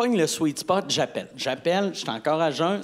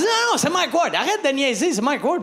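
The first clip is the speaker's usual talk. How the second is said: excited; 8 semitones higher, 4 dB louder than usual. Retold or imitated. Ex voiced, high and excited.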